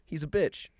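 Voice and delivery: ordinary delivery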